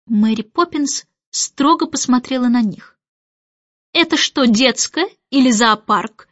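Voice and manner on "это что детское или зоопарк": usual speaking voice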